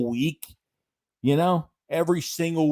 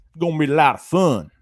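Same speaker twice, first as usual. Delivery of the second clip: louder, hammed-up country boy accent; playful put-on country boy voice, a touch louder; 3 semitones higher, 6 dB louder than usual. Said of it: "act like I'm a country boy".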